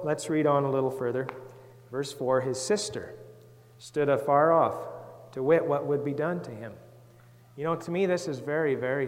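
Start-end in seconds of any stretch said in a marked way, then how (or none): 2.4-6.7 put-on measured Bible reading for children; scripture reading. Reads the Bible aloud to children.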